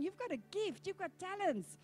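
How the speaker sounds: high, loud, mounting pep talk; insistent encouragement. Acted. God coaxes the reluctant believer about their gifts, insistently. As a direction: urge them on, building in volume.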